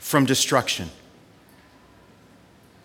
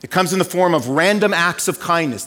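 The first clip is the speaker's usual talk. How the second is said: ordinary talk, nothing marked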